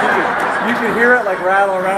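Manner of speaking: high laughing voice. Real, not acted. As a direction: laugh through it. Laughing about coins in mouth.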